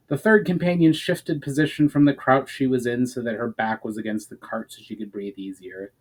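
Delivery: plain reading voice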